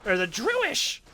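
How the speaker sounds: high voice saying "Druish" as DROO-ish; Luann mispronouncing. Retold or imitated. Luann mangles Druids into "Druish", high and loud. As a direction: squeak out "Druish" like a confused teen.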